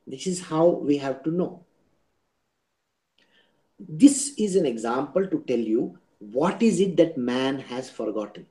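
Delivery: ordinary delivery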